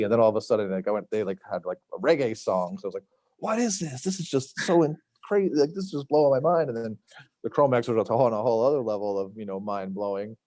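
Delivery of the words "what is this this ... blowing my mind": put-on high, amazed retelling of a teen's wonder; excited teen. Teen hearing a reggae song on tape, high and amazed. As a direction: gush like a kid whose mind is blown.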